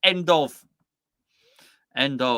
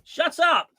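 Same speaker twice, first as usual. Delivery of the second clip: mock shout; 11 semitones higher, 5 dB louder, slower than usual. Mock telling off a viewer.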